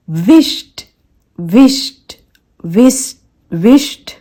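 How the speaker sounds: loud "wisht" for विश्ड; one-syllable विश्ड with tiny t; soft T ending. Shown as a demonstration. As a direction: end विश्ड on t, no vowel. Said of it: "तो ये टा".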